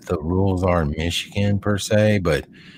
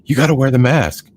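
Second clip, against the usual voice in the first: higher, louder mock instruction; bossy friend; 6 semitones higher, 5 dB louder than usual. Friend at the store demands he wear a mask, louder. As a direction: insist like a rule-keeper. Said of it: "he's like".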